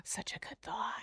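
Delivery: hushed, awed whisper; real awed whisper. Real, not acted. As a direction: breathe it out in awe. Awed reaction to theory.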